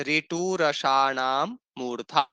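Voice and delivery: a teacher's slow chant of the sutra; slow recitation. Shown as a demonstration. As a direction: sound out ऋटु रसाणाम मूर्धा slowly and clearly. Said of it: "इसमें भी एक सूत्र आता है".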